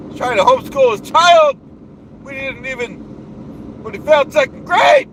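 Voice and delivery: blustering self-important boss voice; pompous ranting persona. Acted. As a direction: puff up like a bragging strongman. Holds the Duke persona rant.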